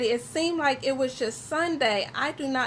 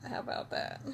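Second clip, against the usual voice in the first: whisper; 13 dB quieter, faster than usual. Real, not acted. Fixing setup while live.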